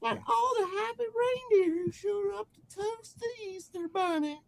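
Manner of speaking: very high falsetto twang; LaFranc's twangy accent. Acted. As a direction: ramble in a squeaky twangy voice. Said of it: "in his brand new twangy accent".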